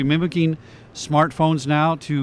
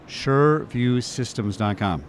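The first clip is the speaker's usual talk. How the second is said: slow, deliberate spelling-out of a web address; careful unhurried voice; much slower than usual. Shown as a demonstration. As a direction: say each part slowly and clearly. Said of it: "Let me say this slowly".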